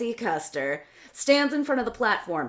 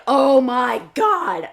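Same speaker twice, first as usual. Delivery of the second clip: stunned; 4 semitones higher, 9 dB louder, much slower than usual. Real, not acted. Shocked reaction to crude scene.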